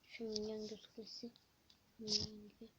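a soft unvoiced whisper to himself; hushed whisper at the screen. Real, not acted. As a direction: whisper it like a secret. Whispered comment while playing.

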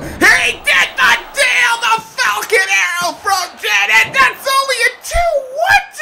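high, loud stunned yelling; excited shout. Real, not acted. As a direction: scream it in disbelief. Stunned near-fall, commentator erupts.

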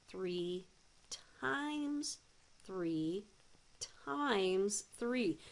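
each "times" a step up, lingered on; stress on times. Shown as a demonstration. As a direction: lean hard on each "times". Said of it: "I'm emphasizing the times".

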